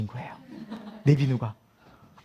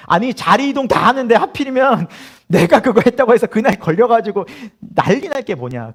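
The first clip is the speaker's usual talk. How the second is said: raised, loud comic grumbling; aggrieved complaint; 6 semitones higher, 5 dB louder than usual. Retold or imitated. Recruit moaning about being the one caught, comic exasperation. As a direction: protest the unfairness, slowing at the end.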